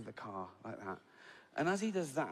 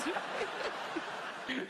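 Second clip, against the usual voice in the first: much higher helpless giggle; high giggle fit. Retold or imitated. Brother Larry cracking up at the funeral, high helpless giggle. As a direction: let the giggle run away high. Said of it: "Larry eventually sort of started doing this".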